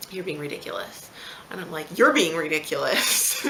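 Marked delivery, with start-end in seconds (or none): 0.1-1.1 retold husband's low-key dismissal, softer; quiet retort. Husband's remark, thrown back after. 2.0-3.4 louder, slowed-down retort; retold comeback. Throws husband's words back at him.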